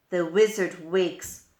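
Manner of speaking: ordinary delivery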